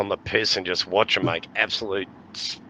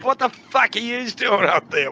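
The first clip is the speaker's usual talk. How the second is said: cop yelling up; 12 semitones higher than usual. Retold or imitated. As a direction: shout up at the idiots. Cop shouting, drunk reply.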